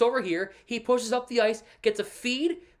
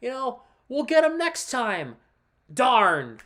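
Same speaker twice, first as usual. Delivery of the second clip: mock cheerful pretending; 4 semitones higher, 3 dB louder, slower than usual. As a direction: fake upbeat shrug-off, higher and slow at first. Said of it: "I wanna sit here and pretend".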